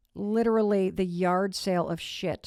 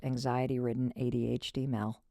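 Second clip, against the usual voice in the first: ordinary delivery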